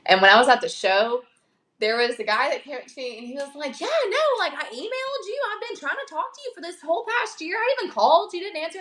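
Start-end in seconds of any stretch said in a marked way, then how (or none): 3.8-8.8 high-pitched retold complaint; imitated grievance in a raised voice; mimicking a complaining man. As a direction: pitch it way up as an aggrieved caller.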